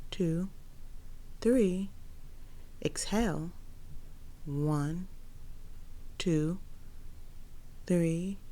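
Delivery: slow, soft breath counting. Acted. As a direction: count the breaths slowly and softly.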